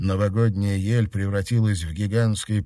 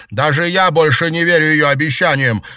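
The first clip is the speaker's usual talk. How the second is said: high, loud, impassioned tirade; anguished fury; 8 semitones higher, 5 dB louder than usual. Acted. Betrayed girl vowing to destroy her enemy, anguished fury. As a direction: cry out the pain and the threat.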